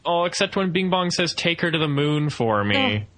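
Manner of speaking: ordinary delivery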